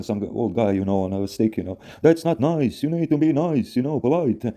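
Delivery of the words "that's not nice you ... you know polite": put-on old man scolding.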